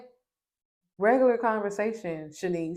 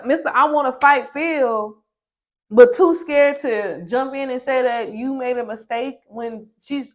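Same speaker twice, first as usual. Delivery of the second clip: loud, raised calling-out; scolding rant; 4 semitones higher, 8 dB louder than usual. Real, not acted. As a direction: tell him off, fired up. Scolding the housemate on screen.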